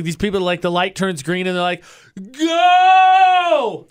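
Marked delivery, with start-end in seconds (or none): 2.7-3.9 long, high mock holler; impatient yell. Driver behind at a green light, mock impatient yell.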